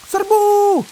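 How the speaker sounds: put-on girl shouting a charge; shouted charge. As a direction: yell it like a charge. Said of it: "Xiaoyu berteriak".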